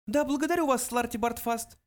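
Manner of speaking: high mouse voice, offhand; offhand little mouse; careless dismissal. A narrator's reading. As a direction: thank him carelessly in a tiny high voice. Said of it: "небрежно произнёс Бенджи".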